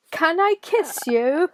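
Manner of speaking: drawn-out British suitor impression; put-on British accent. Friend mimicking the British date asking for a kiss, hammed-up British accent. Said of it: "hoping that you would do the accents".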